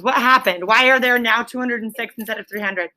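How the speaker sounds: ordinary talk, nothing marked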